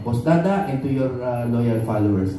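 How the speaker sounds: ordinary delivery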